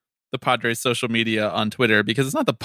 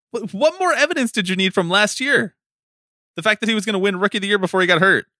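high-pitched fast rant; mad rant; 7 semitones higher than usual. Real, not acted. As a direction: rush it out in exasperation. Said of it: "I'm just so mad".

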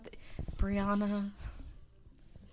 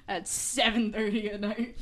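slow loud announcer; news anchor; 6 dB louder, much slower than usual. Pretend.

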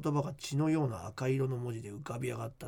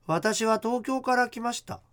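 higher, louder, slow eerie line; possessed girl voice; 9 semitones higher, 6 dB louder, slower than usual. Acted. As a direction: speak as if possessed.

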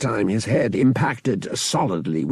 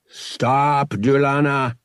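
commanding shout; 5 semitones higher, 5 dB louder, much slower than usual. Acted. Brother aims blaster at Wookiee.

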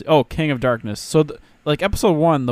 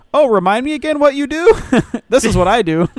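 hammed-up anime characters, high and loud; mock exposition dialogue; 9 semitones higher, 7 dB louder than usual. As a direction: overplay a clumsy info dump. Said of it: "They're like".

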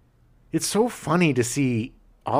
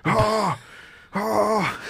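overwrought actor voice; 9 semitones higher, much slower than usual. Retold or imitated. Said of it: "I blame".

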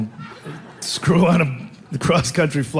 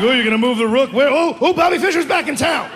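high, excited play-by-play; hammed-up awe at a chess match; mock chess commentary; 8 semitones higher than usual. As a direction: gush like a chess fan watching a move.

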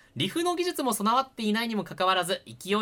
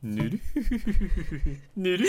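Koro-sensei impression; slower than usual. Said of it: "モノマネお願いします".

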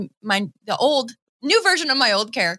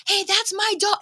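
high-pitched Boston accent, imitating proud dad; 11 semitones higher than usual. Dad telling everyone about his daughter, high Boston-accented boast. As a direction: imitate a bragging Boston father, much higher. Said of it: "So his accent".

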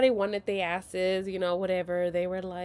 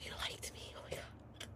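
hushed, breath-only disbelief; awed whisper; 17 dB quieter than usual. Retold or imitated. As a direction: whisper it as if too stunned to speak. Replaying awe at being liked.

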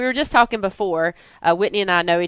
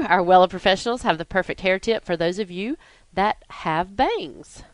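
ordinary talk

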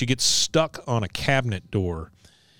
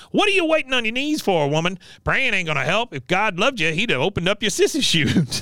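high-pitched mock tirade; Cotton; 9 semitones higher than usual. Recapper as Cotton scorning a fallen woman's praying, high-pitched berating. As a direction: harangue her in a high character voice. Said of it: "Cotton goes out and goes".

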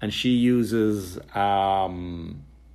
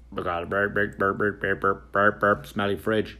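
walkie-talkie static; 3 semitones lower than usual. Retold or imitated. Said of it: "on the walkie-talkie, like".

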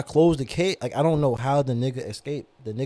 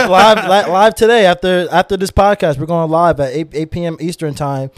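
loud, higher hype shout; hype announcement; 5 semitones higher, 11 dB louder than usual. Real, not acted. Announcing the Discord live to listeners, loud and hyped. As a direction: pump up the audience for the live.

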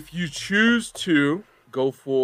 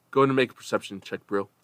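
usual speaking voice

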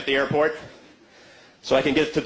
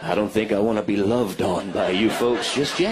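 much lower, dry wary reply; 8 semitones lower than usual. Retold or imitated. Comic answering the pastor, low and dry. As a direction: back away politely. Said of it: "I'm going".

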